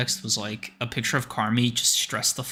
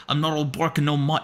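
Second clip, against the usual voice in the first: half-laughing attempt at a British accent; hammed-up British accent; 4 semitones higher than usual. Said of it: "Fuck is that accent?".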